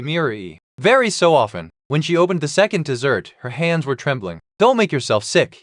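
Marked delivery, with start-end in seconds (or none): none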